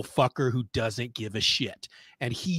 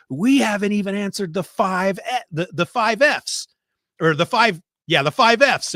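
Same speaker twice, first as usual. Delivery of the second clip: higher, exasperated mock yell; imitated yelling; 7 semitones higher, 5 dB louder than usual. Spy boss yelling at his agents, mock yell. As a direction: yell it like a fed-up boss, hammed up. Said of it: "yelling at him at one point".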